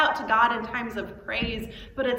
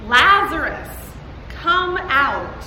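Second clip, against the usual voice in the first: loud cry; 4 semitones higher, 10 dB louder, much slower than usual. Acted. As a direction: call out loudly, drawn out and higher. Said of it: "cried out in a loud voice".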